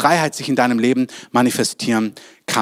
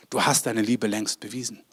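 normal talking voice

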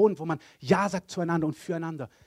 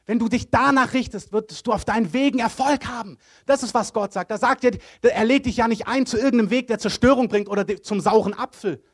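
raised, high-pitched, emphatic sermon delivery; full-force preaching; 5 semitones higher, 5 dB louder than usual. Real, not acted. Preacher urging listeners to follow God's word, fervent and loud. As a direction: drive each condition home loudly.

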